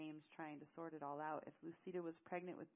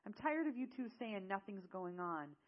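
louder, exasperated telling-off; scolding; 3 semitones higher, 7 dB louder than usual. Acted. Zale fed up with two friends hiding something, scolding. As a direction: scold them, fed up and forceful. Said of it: "Zale scolded".

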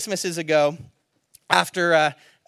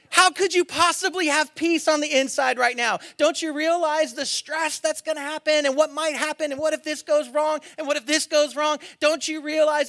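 mock anxious worrier; 10 semitones higher, 5 dB louder than usual. Voicing anxious person scolding peaceful one.

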